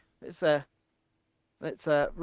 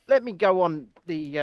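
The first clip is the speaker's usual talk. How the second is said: usual speaking voice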